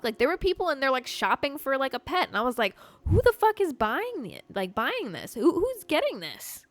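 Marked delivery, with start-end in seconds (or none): 3.1-6.7 high, loud outraged disbelief. Retold or imitated. Vlogger recalling pet store prices, high loud outraged disbelief. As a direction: throw the question out, appalled and loud.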